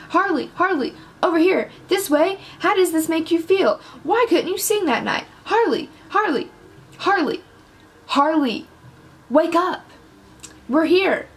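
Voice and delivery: high-pitched, insistent calls for attention; calling out. A narrator's reading. Photographers swarm Harley for answers, calling out insistently. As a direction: voice a pressing crowd of reporters.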